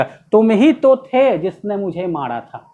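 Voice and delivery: pointing out, ही stressed; accusing stress. Retold or imitated. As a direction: accuse the friend, lean on ही. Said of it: "point out किया जाता है". (दोस्त पर इल्ज़ाम लगाते हुए "ही" पर ज़ोर)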